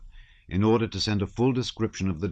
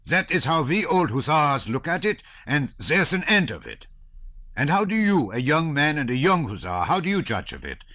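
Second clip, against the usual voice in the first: put-on bluff old hussar; 9 semitones higher, 5 dB louder than usual. A narrator's reading. Old hussar challenging young Nicholas.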